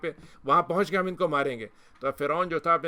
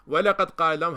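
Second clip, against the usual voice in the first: louder recited Arabic phrase; Quran recitation; 2 semitones higher, 7 dB louder than usual. Acted. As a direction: intone the verse strongly. Quran verse recited within talk. (بلند آواز میں تلاوت)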